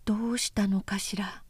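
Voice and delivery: soft unvoiced whisper. Obun quietly asking Oyoshi about the fight, whispered. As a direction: ask it under your breath.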